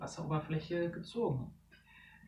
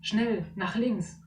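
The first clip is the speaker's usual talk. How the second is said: slow, higher urgent shout; urgent call; 5 semitones higher, 4 dB louder, slower than usual. Acted. Tiberius steering Dara away, urgent. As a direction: direct her sharply to the left. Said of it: "ruft Tiberius".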